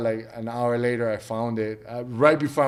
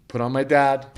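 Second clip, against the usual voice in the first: ordinary talk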